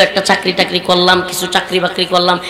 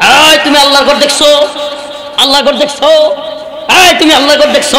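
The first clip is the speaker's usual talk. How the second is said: imitated furious shout, higher and louder; enraged shouting; 4 semitones higher, 4 dB louder than usual. Angered leader hits back at the youths, acted furious shouting. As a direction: bellow it as an indignant challenge. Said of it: "এক ক্ষেপে গিয়ে কয়".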